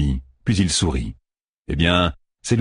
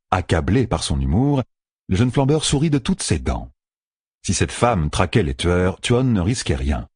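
ordinary delivery

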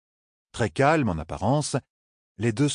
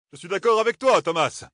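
voix bien plus aiguë et forte; lance-le avec aplomb; Darlene décidée; 12 semitones higher, 4 dB louder than usual. A narrator's reading. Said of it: "lança Darlene". (Darlene approuve Thomas, voix aiguë et ferme)

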